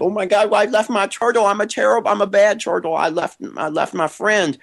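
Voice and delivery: very high, squeaky little creature; high-pitched panicky turtle puppet. Pretend. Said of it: "I'm speaking as the turtle".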